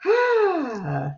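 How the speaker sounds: high, airy sigh of relief; relaxed sigh. Acted. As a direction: let the tension go on a long ah. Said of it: "give me a, a sense of relaxation".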